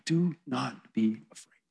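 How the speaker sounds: measured, hushed comfort with each word set apart; gentle reassurance. Acted. Jesus reaching down to fallen John, slow reassurance. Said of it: "says to John".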